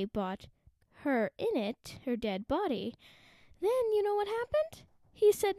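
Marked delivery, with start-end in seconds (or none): 3.6-5.6 excited. Real, not acted. Building up to the twist.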